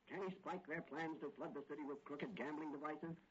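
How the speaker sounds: ordinary talk, nothing marked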